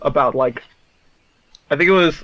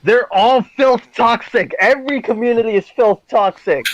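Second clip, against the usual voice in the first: exaggerated emphatic declaration; 6 semitones higher than usual. Real, not acted. Exaggerated declaration about communities.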